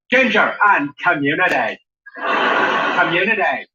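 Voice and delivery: mock Belfast, last word stretched out; Belfast accent. Shown as a demonstration. Comedian repeats the phrase that helps, Belfast accent. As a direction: stretch out COMMUNITY in Belfast vowels. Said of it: "to get the Belfast accent right".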